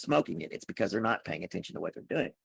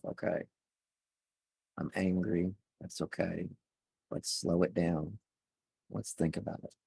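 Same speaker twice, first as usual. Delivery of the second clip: calm self-talk; 3 semitones lower, 6 dB quieter than usual. Shown as a demonstration. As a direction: ease the anger out of your own voice. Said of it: "notice how my tone of voice just changed".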